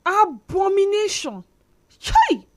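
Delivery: loud exclamations of shock; shouting. Retold or imitated. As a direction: cry out in outrage. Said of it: "you know how we used to shout".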